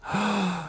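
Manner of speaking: sharp, full inhale; big breath in. Acted. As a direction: suck in air before a dive. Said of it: "takes a big breath of air".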